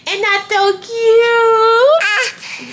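put-on speaking for the baby in a squeaky high voice, slow; high baby voice for daughter.

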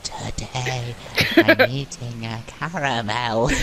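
laughing through a slow mock witch voice; slow, giggly witch whisper. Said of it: "You don't have to sound like a witch".

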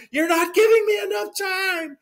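retold secretary shouting down the phone; shrill protest. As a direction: yell it back in high-pitched panic. Secretary protests on ransom call.

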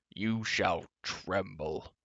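slow, drawn-out threat in a giant's voice; quavering giant. A narrator's reading. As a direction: intone it very slowly, as a quavering monster. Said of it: "the quavering voice cried again".

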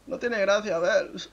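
high-pitched mock whine; mock complaint. Imagined viewer complaining to Belz about the joke, mock whine.

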